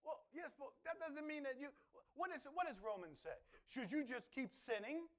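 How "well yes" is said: usual speaking voice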